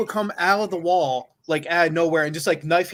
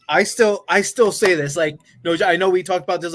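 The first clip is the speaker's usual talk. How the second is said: ordinary talk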